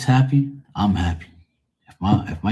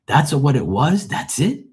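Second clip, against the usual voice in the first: acted disbelief, pitch leaping up; high, rising questions as if stunned it was that simple; incredulous; 7 semitones higher than usual. Nephew amazed at simple fix.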